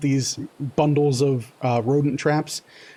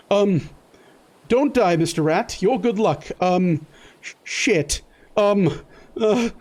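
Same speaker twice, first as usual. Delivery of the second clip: a bit higher, fretful coaxing; flustered Lamont; 5 semitones higher than usual. Acted. Lamont pleading with the injured rat, fretful and a bit higher. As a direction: fuss nervously over the rat.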